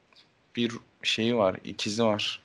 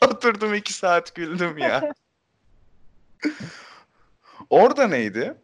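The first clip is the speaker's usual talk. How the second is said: laughing; 9 semitones higher, 4 dB louder than usual. Real, not acted. Recalling laughing scene, laughing.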